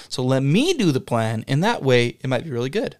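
ordinary talk, nothing marked

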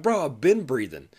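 mock-indignant bro; annoyed retort. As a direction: set the trainee straight. Annoyed victim retorts to trainee.

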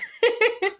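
a short, bright real laugh up high. Real, not acted. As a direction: let a quick laugh burst out. Co-host laughs at the joke.

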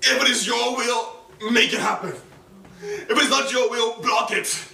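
high, loud, emphatic plea; urgent prayer. Retold or imitated. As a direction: cry out to God, punching each request. Retold urgent prayer to God.

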